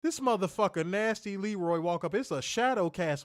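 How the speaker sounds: ordinary talk, nothing marked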